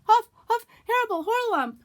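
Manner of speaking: very high, loud squeal for help; panicked cry. Acted. Piglet crying out as he flees the heffalump. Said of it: "still crying out".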